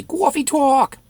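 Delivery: high, loud New York "cawfee tawk"; New York accent. Acted. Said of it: "It's a New York accent".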